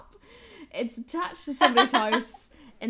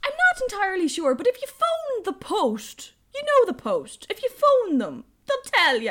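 mock shopkeeper; 11 semitones higher, 7 dB louder than usual. Shopkeeper sends her to phone the post, high loud mock helpfulness. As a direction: ham up a well-meaning local giving useless advice.

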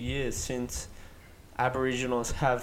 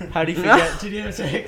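laughing; 5 semitones higher, 9 dB louder than usual. Real, not acted. Teasing friend, laughing.

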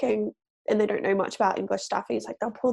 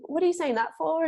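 mock-annoyed question, pitched up to the end; higher, lilting retold complaint; annoyed upward inflection; 7 semitones higher than usual. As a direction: lift "saying that for" high, as if irked. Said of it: "when I'm annoyed".

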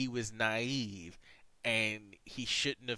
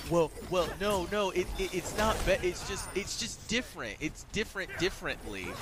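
higher, stammering backpedal; flustered explaining; 8 semitones higher than usual. Retold or imitated. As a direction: fumble for an excuse. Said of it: "tries to explain".

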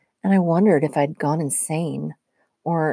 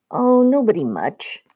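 higher, miffed sarcasm; 6 semitones higher, 4 dB louder than usual. A narrator's reading. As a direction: toss it off like you're still offended. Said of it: "obviously still miffed about the donkey comment".

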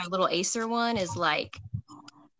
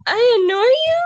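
gasp, then a much higher, louder hurt question; 9 dB louder than usual. Acted. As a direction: play Hufflepuff, wounded and shocked. Reading Hufflepuff's hurt reply.

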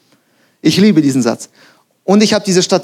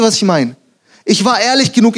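usual speaking voice

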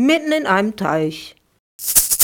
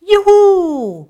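high, loud joyful whoop; cheering; 13 semitones higher, 10 dB louder than usual. A narrator's reading. Maxi cheers on the pagoda roof slide, high joyful whoop. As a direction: whoop for joy sliding down the roof. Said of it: "jubelte Maxi".

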